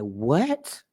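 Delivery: high, rising stunned questions; audience disbelief. Retold or imitated. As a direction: voice the listeners' shock. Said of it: "y'all was gonna be like".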